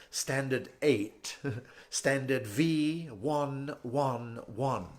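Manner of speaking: very slow, stiff posh British; posh British bank manager. Acted. Bank manager doubting a mortgage applicant, posh British condescension. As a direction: draw the words out in snooty British, fairly soft. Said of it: "high type Oaks who talk like that".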